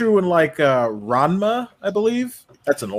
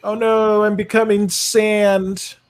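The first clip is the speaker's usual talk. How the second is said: high, drawn-out fake wail; mock dismay; 8 semitones higher, slower than usual. Streamer voicing the movie character turning to sand, mock dismay. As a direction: voice a character helplessly dissolving, slowly.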